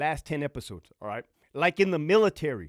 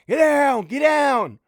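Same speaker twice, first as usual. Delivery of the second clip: sharp, much higher and louder command; shouted command; 9 semitones higher, 11 dB louder, slower than usual. Acted. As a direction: bark it like a guard ordering a prisoner down. Acted guard shouting at inmate.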